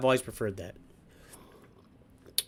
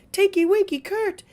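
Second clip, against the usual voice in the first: much higher, sing-song cartoon voice; hammed-up high Teletubby chant; silly character voice; 4 dB louder than usual. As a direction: chirp it like a giddy kids' TV character. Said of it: "but he was like".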